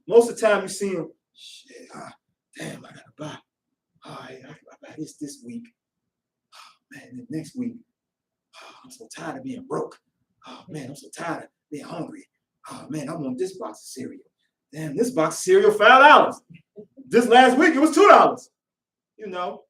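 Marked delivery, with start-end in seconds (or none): 1.3-14.2 low-energy soft mumble to yourself; weary grumbling. Acted. Broke man grumbling to himself about money, weary muttering. 14.7-18.5 louder, building indignation over a price; mock outrage. Shopper complaining about a cereal price, loud mock outrage.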